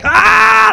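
very high, very loud battle scream; full scream. Retold or imitated. Charging the scally with his sword, all-out scream. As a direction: scream with everything you have. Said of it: "I screamed as loud as I could".

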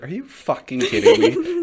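high, loud, laughing mock outrage; mock disbelief. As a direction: act floored by the slime gift, laughing. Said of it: "I was like".

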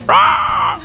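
high screeching squawk; pterodactyl shriek. Shown as a demonstration. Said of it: "I'll just sound like a pterodactyl".

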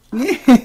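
high cackling laugh; witch cackle. Acted. Witch cackles before speaking.

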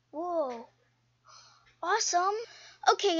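usual speaking voice

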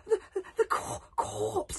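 high, halting stammer; frightened stutter. A narrator's reading. Hiccup telling Gobber about the corpse, stuttering. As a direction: trip over the word in fright. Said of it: "stuttered Hiccup".

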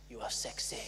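put-on low, whispered French accent; sexy French accent. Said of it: "Speak with a French accent".

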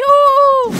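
high, loud "woo"; excited whoop. Pretend. Swimmer whooping as the group sets off, excited. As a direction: let out a high playful whoop. Calling all to swim off.

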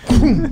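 a playful high whoosh as a move lands; mock dance sound. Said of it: "And I go".

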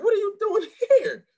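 high, loud laughing disbelief; shocked. Retold or imitated. Director shocked to see him at the event, laughing. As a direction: gasp it out amazed. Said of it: "he was really shocked".

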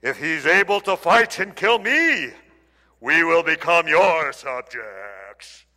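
slow, booming giant voice; giant's voice. Retold or imitated. Preacher imagines Goliath taunting Israel, slow booming giant. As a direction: draw it out like a huge warrior. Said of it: "I can imagine what his voice was like".

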